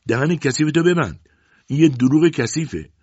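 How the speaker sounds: high, loud furious outburst; angry. A narrator's reading. Angry mother lunging at her daughter, furious shout. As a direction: lash out in fury. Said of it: "روزا عصبانی شد".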